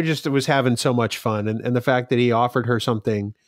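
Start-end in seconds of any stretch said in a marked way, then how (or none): none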